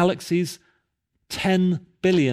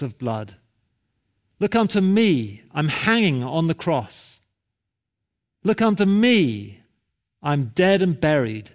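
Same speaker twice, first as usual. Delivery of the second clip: impassioned preacher; 2 semitones higher than usual. Retold or imitated. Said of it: "he went on in this way".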